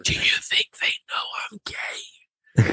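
slow, worried whisper to Dustin; mock nervous whisper. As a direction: whisper the question like you fear being overheard. Retold anxious whisper to friend.

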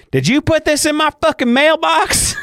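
hammed-up furious wife confronting mailman; mock angry wife. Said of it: "and goes".

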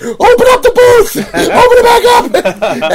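very high, loud shout, laughing through it; excited shout. Retold or imitated. Telling the crew to reopen the booth for [name], a laughing excited shout. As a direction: yell to the crew, half laughing with glee.

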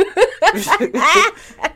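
loud high burst of laughter. Real, not acted. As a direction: crack up loudly. Laughs hard at own joke.

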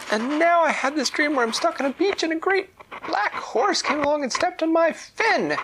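high, loud distressed fish voice; upset pufferfish. Acted. Pufferfish telling the fairy its nightmare, high and upset. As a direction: pour out the bad dream. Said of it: "And Ramuna said".

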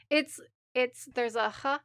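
ordinary talk

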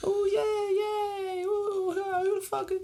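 high squealing crowd cheers; cheering crowd. Acted.

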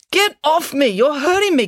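high, loud cry of protest; loud complaint. A narrator's reading. Georgia pinned under Ingrid's knee, complaining loudly. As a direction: yell in pained protest, pitched right up. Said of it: "complained Georgia".

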